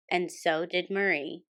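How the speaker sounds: ordinary delivery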